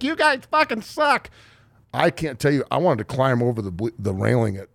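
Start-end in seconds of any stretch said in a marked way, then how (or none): 0.0-1.3 a high-pitched jeering fan, lightly done; mock heckler. Fan yelling at the team from the stands, mock jeer. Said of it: "be like".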